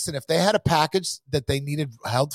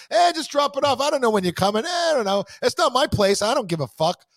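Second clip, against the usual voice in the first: mocking dismissive; 10 semitones higher than usual. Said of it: "don't just fucking say".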